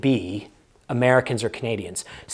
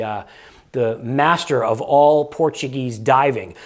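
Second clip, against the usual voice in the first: usual speaking voice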